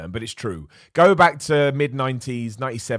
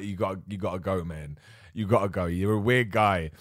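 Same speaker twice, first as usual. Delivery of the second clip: mock brush-off; 5 semitones lower, 4 dB quieter than usual. Imitating a passerby brushing him off.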